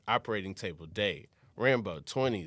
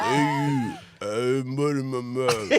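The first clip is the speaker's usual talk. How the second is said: Rambo voice; 5 dB louder than usual. Acted. Rambo with mud in mouth.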